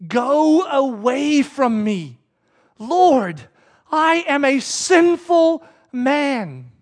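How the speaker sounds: very slow, drawn-out plea; solemn, weighty plea read in character; pleading. Acted. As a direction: stretch each phrase out, with heavy pauses. Reads Peter's plea at Jesus' knees.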